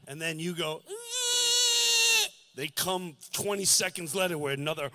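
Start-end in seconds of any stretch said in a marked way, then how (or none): 0.9-2.3 high drawn-out rattling gasp for air; dying gasp. Retold or imitated. Showing listeners how a dying father breathes, high gasp. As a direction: imitate a dying man's last breath.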